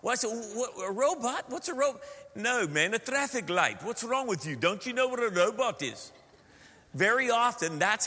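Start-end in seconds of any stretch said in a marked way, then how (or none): 0.3-2.0 higher, incredulous questioning; comic high-pitched confusion; baffled questioning. Retold or imitated. Bewildered American reacts again. 2.3-6.1 put-on exasperated Afrikaans-accented English; impatient Afrikaner accent; South African accent. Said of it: "Now that's a South African accent of an Afrikaans".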